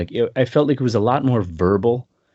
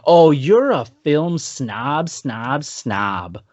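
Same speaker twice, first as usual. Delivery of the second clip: mock yell; 3 semitones higher, much slower than usual. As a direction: shout it slowly like a heckler. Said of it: "he's at the top of his voice".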